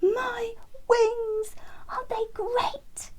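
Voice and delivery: very high, breathy delighted bird voice; high breathy delighted wren; excited wren. A narrator's reading. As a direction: show off new wings with glee. Terence showing off wings.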